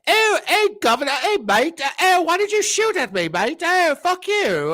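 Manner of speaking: high, hammed-up English accent; squeaky mock Brit, mate. Mocking English gamers online.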